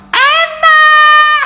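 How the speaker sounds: high, loud cry of yum; delighted outburst. Real, not acted. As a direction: burst out with delight.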